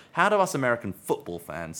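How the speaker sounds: ordinary delivery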